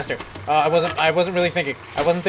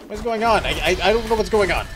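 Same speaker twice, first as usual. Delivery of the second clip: ordinary talk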